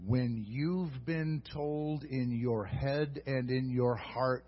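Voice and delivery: ordinary talk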